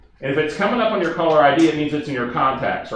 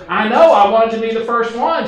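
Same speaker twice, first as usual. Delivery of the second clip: higher, louder eager caller voice; Barbara's voice; 8 semitones higher, 6 dB louder than usual. Retold or imitated. Barbara tells Steve she wanted to be first, higher and louder. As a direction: pitch it up, proud to be first. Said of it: "I'm gonna talk like Barbara for a minute".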